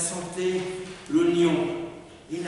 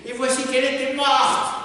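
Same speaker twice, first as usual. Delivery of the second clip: higher, louder outcry at a death; anguished cry; 8 semitones higher, 7 dB louder than usual. A narrator's reading. Man learning of her death, anguished cry. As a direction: cry it out, high and loud. Narrator cries out his shock.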